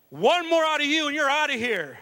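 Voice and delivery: high, loud referee warning; stern warning. Retold or imitated. Referee warning the dad in the bleachers, loud and stern. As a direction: lay down the law as the ref. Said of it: "he said".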